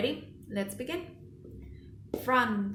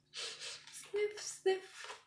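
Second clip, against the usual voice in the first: soft sniffles; 10 semitones higher, 8 dB quieter than usual. Shown as a demonstration. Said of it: "He's almost crying".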